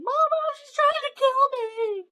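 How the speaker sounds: high-pitched hammed-up child's wail; mock screaming and crying. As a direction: imitate a hysterical kid wailing for mama. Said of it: "freaking out, screaming, crying".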